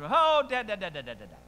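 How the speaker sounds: mock-hasty pleading child; pleading kid. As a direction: son stalling his father, hammed-up. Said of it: "if he could have worded it this way".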